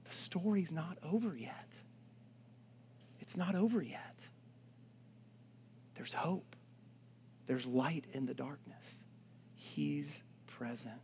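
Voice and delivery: soft, breathy half-whisper of hope; hushed hopeful preaching. Acted. Hushed hope after the story.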